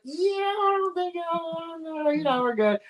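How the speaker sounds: high, slow mock voice politely turning down a price; polite refusal. Telling the shopkeeper no thanks in a high, drawn-out mock voice. I was like.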